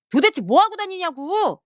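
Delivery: husband snapping at his wife; angry yell. Acted. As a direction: demand an answer, fed up. Husband yelling at ignoring wife.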